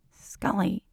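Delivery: a mild, dismissive protest; scoffing. A narrator's reading. He scoffs at her doctor act, mildly and quietly. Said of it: "and he scoffs".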